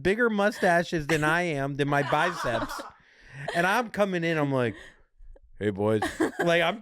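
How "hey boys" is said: lower-pitched, lightly put-on tough guy; a hint of manly swagger; would-be protector. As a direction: play the protector greeting the guys. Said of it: "trying to be, like, fucking the protector".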